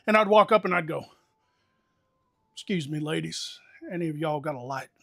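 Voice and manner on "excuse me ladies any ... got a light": put-on courteous Southern accent, softly spoken; thick Southern accent. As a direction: lay on the Southern accent to charm strangers. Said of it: "I'd lay it on thick".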